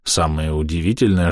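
usual speaking voice

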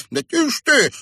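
very high, loud urgent hushing; high, desperate plea; pleading. A narrator's reading. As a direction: beg her to be silent. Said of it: "умоляюще произнёс Пот".

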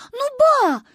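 high, loud whiny protest; high-pitched childish indignation; indignant child. A narrator's reading. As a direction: protest to grandma like an outraged girl. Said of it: "возмутилась Манюня".

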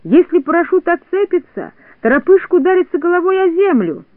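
high-pitched urgent warning; alarmed cry. A narrator's reading. Doctor Pilyulkin warns that Toropyzhka may fall, alarmed cry. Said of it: "закричал доктор Пилюлькин".

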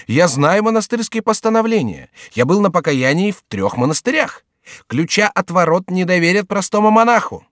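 high-pitched insistent argument; animated high character voice; incredulous jester. Acted. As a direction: argue in disbelief, voice held high. Chicot disputes the monk.

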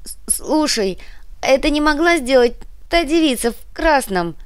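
stammering through chattering teeth, high. A narrator's reading. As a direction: speak while shivering, teeth clattering. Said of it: "стуча зубами".

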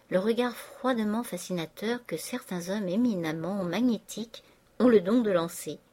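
ordinary delivery

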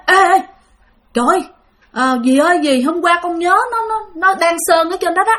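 excited telling. Retold or imitated. Coworker excitedly tells grandma.

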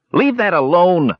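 mild, high-pitched indignant shout; kender's shout. A narrator's reading. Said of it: "shouted the kender".

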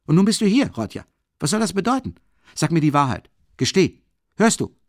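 loud, high, furious reproach; angry scolding. A narrator's reading. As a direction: scold him hard, building to a demand. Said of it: "plötzlich flammte Zorn".

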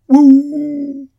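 high, loud drawn-out dog howl; a loud, mournful hound howl. A narrator's reading. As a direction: howl up at the sky like an old dog. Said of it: "répondit le chien en levant son nez".

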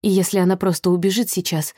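ordinary delivery, nothing marked

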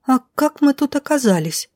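put-on high-pitched, dazed girl's question; bewildered mumble. A narrator's reading. Dazed girl blinking in the light asks, bewildered mumble. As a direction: ask it high and puzzled, half to yourself. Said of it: "пробормотала Милена".

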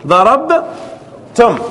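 ضربتم pulled out slowly to the final م; slow drawn-out demo. Shown as a demonstration. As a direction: stretch the ending for the class. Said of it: "teacher drawing out verb form". (نطق ضربتم ببطء شديد)

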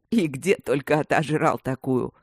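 sneering giggly taunt; mocking, giggling. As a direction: jeer at him with a snicker. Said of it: "глумливо захихикали сзади".

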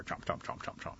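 soft, rapid unvoiced chomps; munch through the words; imitated chomping. Gator chews on the tree, chomping noises.

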